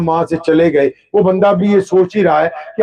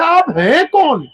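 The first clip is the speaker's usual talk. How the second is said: higher, slower, insistent plea; pleading question; 8 semitones higher, slower than usual. Retold or imitated. The stranger, sent away, begs to know who he is. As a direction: lift آپ ہیں کون high and lean on کون, falling at the end.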